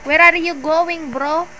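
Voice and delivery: calling out, loud and drawn out; slow, loud, pleading shout to a leaving friend; loud slow call after him. Real, not acted. Streamer yelling at teammate who drove away, loud and slow. Teammate driving off, left behind.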